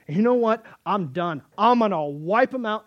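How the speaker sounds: loud, exasperated outburst; fed-up God. Retold or imitated. Preacher voices angry God.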